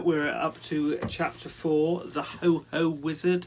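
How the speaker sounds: slow, bright title announcement; chapter announcement. Acted.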